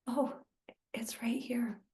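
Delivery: hushed whispered awe. Retold or imitated. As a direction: breathe it in wonder. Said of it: "And then we go like".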